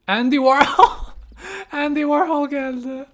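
high, loud, slow starstruck cry; starstruck, drawing the name out; excited gasp. Real, not acted. Player spotting a celebrity, starstruck excited cry.

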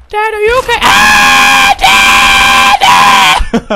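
put-on piercing high child screams; panicking son. Son checking on crashed Dad, shrieking in panic. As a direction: scream for Dad in a kid's falsetto.

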